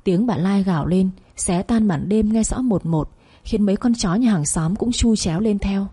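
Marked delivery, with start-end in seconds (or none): none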